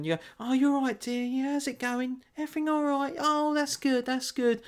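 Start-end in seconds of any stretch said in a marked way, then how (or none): 0.4-1.2 put-on higher and gentle, sweet old-dear tone; softly spoken voice. Matching an older softly spoken caller, higher and sweet. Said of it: "a bit more softly spoken". 1.2-4.6 higher and softer, sweet and gentle; softly spoken voice. Retold or imitated. Chatting to an older softly spoken caller, higher and softer. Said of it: "a bit more softly spoken".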